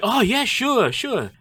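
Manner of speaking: high, bright-toned mock server; chirpy American server. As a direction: play the suddenly cheerful server.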